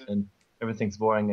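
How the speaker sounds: usual speaking voice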